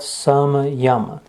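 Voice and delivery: समयम drawn out slowly as two parts, सम and यम; split into parts. Shown as a demonstration. Teacher explaining what संयम means, समयम split slowly in two. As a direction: break समयम slowly into सम, then यम. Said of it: "उसका संधि विग्रह होता है".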